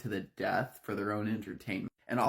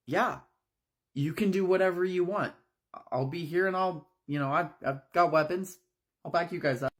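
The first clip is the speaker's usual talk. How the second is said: eager encouragement; 7 semitones higher, 3 dB louder than usual. Acted. Oliver encourages women's revenge.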